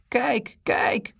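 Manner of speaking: high-pitched jeering call; taunting high cry. A narrator's reading. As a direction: call after them in a high voice. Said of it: "riep een stem achter hen".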